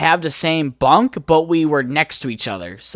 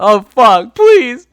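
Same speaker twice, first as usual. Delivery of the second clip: higher, louder frantic begging; panicked pleading; 9 semitones higher, 8 dB louder than usual. Retold or imitated. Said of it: "I was like".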